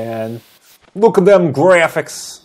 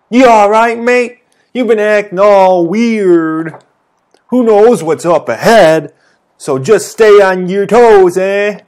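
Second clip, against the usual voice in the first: put-on British accent, higher and louder; British girl, loud and rattled; British character voice; 6 semitones higher, 7 dB louder than usual. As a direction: shout it like a startled British lass. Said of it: "I don't know what kind of voice to give her".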